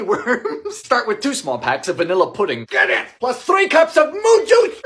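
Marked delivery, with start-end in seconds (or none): 3.2-4.8 silly voice. Acted. Silly name for milk.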